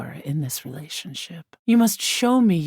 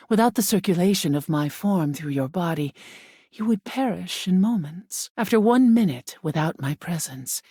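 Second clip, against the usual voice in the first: usual speaking voice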